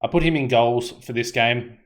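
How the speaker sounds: normal talking voice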